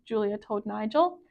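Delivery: usual speaking voice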